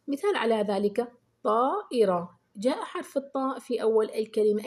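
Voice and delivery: normal talking voice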